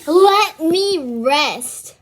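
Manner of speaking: loud, slow drawn-out let me rest; quoting the cat. Retold or imitated. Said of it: "He said".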